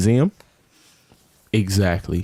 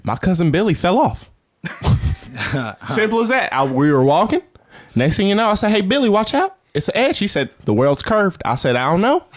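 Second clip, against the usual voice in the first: tells the Billy story in a high put-on accent, pitched well up; a joking put-on regional accent, high and bouncy; 8 semitones higher than usual. Mocking the flat earthers with a high put-on accent. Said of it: "I don't know why I did that accent".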